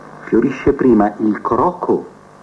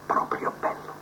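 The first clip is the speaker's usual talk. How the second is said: whispered; 12 dB quieter than usual. Acted. Admiring his own work.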